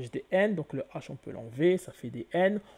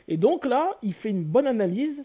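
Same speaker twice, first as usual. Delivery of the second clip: excited praise; 8 semitones higher, 7 dB louder than usual. Real, not acted. Praising an author's analysis.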